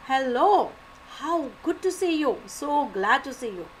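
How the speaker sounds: put-on light up as if you've spotted an old friend; excited greeting. Shown as a demonstration. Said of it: "how am I going to say the sentence".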